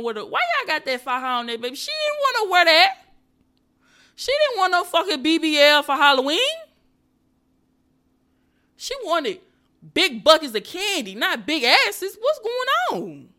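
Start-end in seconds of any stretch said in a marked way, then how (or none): 1.7-13.3 high, loud, exasperated ranting; outraged high rant. Real, not acted. Vlogger ranting to viewers about the costume, high outraged voice. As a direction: shout it out in disbelief.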